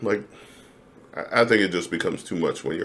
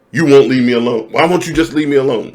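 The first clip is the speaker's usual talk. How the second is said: louder and higher, exasperated; fed-up partner; 5 semitones higher, 8 dB louder than usual. Acted. Acting out a partner snapping at him to back off, loud and higher. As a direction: push them away in frustration.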